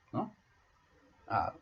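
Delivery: ordinary talk, nothing marked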